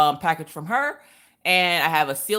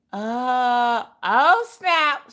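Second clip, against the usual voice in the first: mock surprise; 6 semitones higher, 4 dB louder, much slower than usual. Surprise at the tag.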